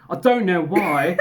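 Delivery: put-on British accent, slow. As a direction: read it as a mock British man, slowly. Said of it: "Oh wait, he's British".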